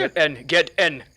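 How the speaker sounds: loud, pressing command; urgent shout. Acted. Iqbal shouting at Hilliard to get in the car, urgent. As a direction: bark it twice, no time left. Driver urges passenger into car.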